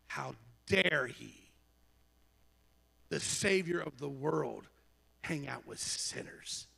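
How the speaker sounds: low, slow, hushed indignation; disapproving murmur. Acted. Preacher voicing the murmuring followers, slow disapproving murmur. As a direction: draw it out softly, word by word. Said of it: "they all murmured".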